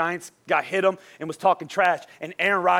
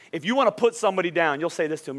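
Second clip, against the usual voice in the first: usual speaking voice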